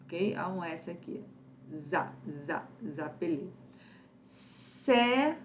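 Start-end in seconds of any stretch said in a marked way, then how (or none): 1.7-3.5 soft, low za za zapelé, pressing the z; z sound drill. Shown as a demonstration. Teacher isolates the liaison z sound for students. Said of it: "le son des Z".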